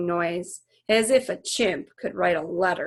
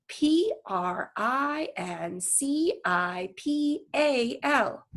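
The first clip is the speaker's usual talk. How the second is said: slow, higher letter-by-letter spelling; 6 semitones higher, much slower than usual. Acted. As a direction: spell each letter firmly. Girl spelling word deliberately.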